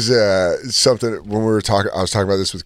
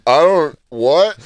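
higher, louder stammered confusion; locking up, stunned; 6 semitones higher, 7 dB louder, much slower than usual. Retold or imitated. Worker retelling his reply to boss's question, locking up. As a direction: re-enact freezing in bewilderment, voice jumping up. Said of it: "locking up and being like".

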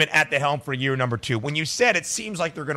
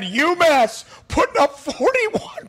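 hyped up; 11 semitones higher, slower than usual. Real, not acted. Hyping a surprise high score.

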